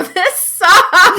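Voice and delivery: high, loud mock complaint, breaking into laughter; mock Ashley wail. Imitating Ashley dodging the knights, high mock wail. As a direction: wail it like a fed-up game heroine. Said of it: "you can just hear her go".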